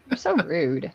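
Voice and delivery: ordinary talk, nothing marked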